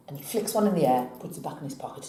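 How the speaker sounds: ordinary delivery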